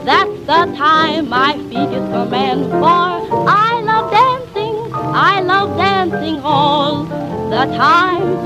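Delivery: ordinary delivery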